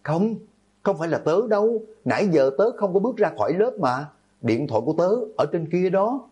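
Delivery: high-pitched, panicked denial; frightened protest. A narrator's reading. Accused classmate explaining she never left the room, frightened. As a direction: plead innocence in a high, alarmed voice. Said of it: "Từ Nhược Băng kinh hãi xua tay giải thích".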